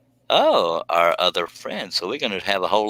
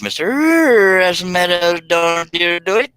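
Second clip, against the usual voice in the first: much higher, louder and slow, ring-announcer style; announcer boom; 11 semitones higher, 7 dB louder, slower than usual. Acted. Host welcoming Russ Meadows to the panel, booming announcer call. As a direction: introduce him like a prizefighter.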